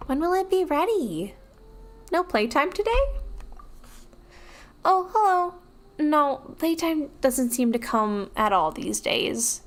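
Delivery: put-on high, sweet kid voice; childish voice. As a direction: speak like a small child. Streamer voicing child NPC dialogue.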